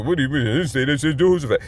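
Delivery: fast, low mock objection; confused first-time listener. Imagined newcomer objecting to the reading, mock protest. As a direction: rattle it off like a baffled listener. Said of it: "They go".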